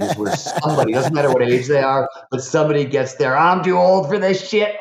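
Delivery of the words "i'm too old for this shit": mock old-man grumble, pitched higher; a jokey put-on grumpy old person; grumpy geezer. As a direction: sneer it like a fed-up old-timer. Mocking the geezer mindset.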